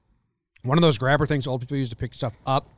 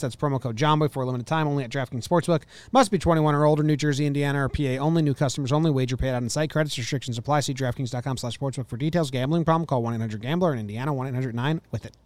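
rapid-fire terms and conditions; fast legal disclaimer; faster than usual. Acted. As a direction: rattle off the fine print. Rushed legal fine print.

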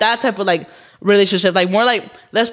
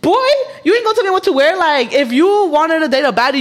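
sassy retort; 5 semitones higher than usual. Real, not acted. Sassy retort to picky boyfriend.

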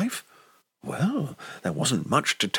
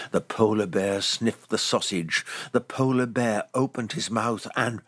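usual speaking voice

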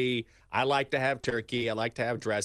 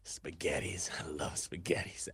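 low, soft imitation of the linebacker; 7 semitones lower, 8 dB quieter than usual. The linebacker repeating his answer to the reporter, low and soft. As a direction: mimic the player, low and quiet. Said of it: "He goes".

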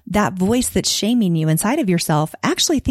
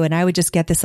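normal talking voice

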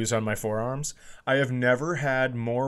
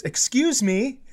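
lady's polite request; 11 semitones higher, slower than usual. Retold or imitated. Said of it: "this lady goes".

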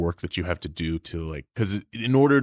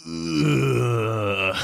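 villain groan; 5 semitones higher than usual. Pretend. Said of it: "if you added, like, a maniacal laugh".

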